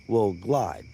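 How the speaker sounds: normal talking voice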